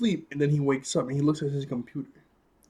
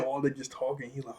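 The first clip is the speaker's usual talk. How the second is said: ordinary talk, nothing marked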